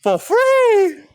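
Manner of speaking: very high, squealed question; mock incredulity. Reacting to co-host on immigrants, mock incredulous. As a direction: shoot way up in pitch, hammed-up disbelief. Mock outrage at co-host's answer.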